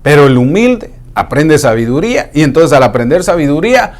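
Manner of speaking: normal talking voice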